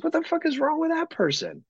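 high, shocked disbelief; baffled outburst. Retold or imitated. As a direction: react like you can't believe your eyes. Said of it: "And I was like".

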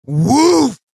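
loud, high mock gunshot boom; imitated gunshot. Retelling grandpa finally firing, a loud mock gunshot boom. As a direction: blast it out like a shotgun firing. The gun goes off.

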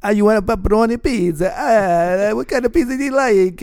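put-on high, hammy Italian accent; Italian pizza-guy accent, high pitched. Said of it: "my Italian accent I do sometimes".

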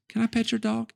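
put-on high, small child voice, lightly done; a touch of a small girl asking politely; dad quoting his little daughter asking a stranger. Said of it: "And she goes".

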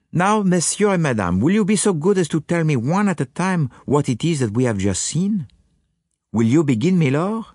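put-on courteous questioning in a French accent; Poirot, beaming. Detective invites the witnesses to speak one at a time, beaming.

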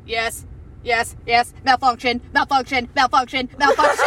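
malfunctioning robot chant; robotic error message. Pretend. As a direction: glitch out like a broken robot. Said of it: "As I'm trying to be fucking fake".

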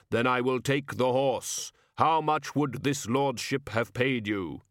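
brook no argument; cold insistence. Acted. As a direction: stranger overriding the shopkeeper. Customer bargains.

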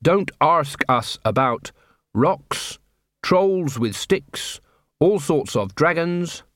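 very slow list with long pauses; reading out a notice. Acted. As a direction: spell out each item slowly, as if deciphering a sign. Reads out a nailed-up notice.

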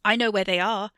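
loud bid for attention; insistent claim. A narrator's reading. Theo tries to reclaim Wesley's waning attention, loudly insistent. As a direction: raise your voice to win them back. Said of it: "trying to reclaim his focus".

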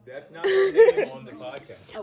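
high, slow and cracking up; giggling objection; laughing protest. Real, not acted.

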